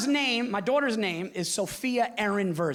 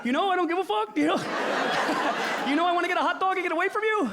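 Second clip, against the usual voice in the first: high, fast laughing brush-off; mock retort; 9 semitones higher, faster than usual. Comic answering a party bore, fast high laughing brush-off. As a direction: sneer it through a laugh.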